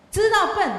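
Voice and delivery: 知道笨 thrown out high and loud as the key point; emphatic. Shown as a demonstration. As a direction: hammer home 知道笨 to the children. Teacher stressing a point to children.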